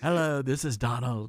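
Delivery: a lightly voiced, half-hearted Trump impression; Donald Trump voice. Host imitating the president calling in, a hint of Trump. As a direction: toss off a weak take on the president's phone greeting. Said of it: "I can't do the accent".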